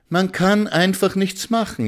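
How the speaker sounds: high-pitched helpless whine; wailing complaint. A narrator's reading. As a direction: lament it with the pitch way up. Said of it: "jammerte Frau Schimanski". (mit hoher Stimme jammernd)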